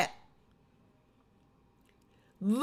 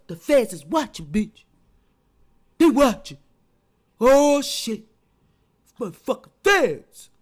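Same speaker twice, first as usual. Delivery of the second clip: voicing Tasha; 3 semitones lower than usual. Voicing Tasha's paranoid thoughts.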